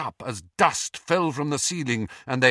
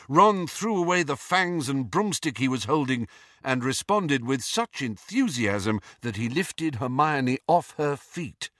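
ordinary delivery

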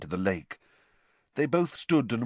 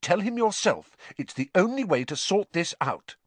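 much higher, clipped refusal; curt Hermione; 12 semitones higher than usual. A narrator's reading. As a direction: cut him off firmly.

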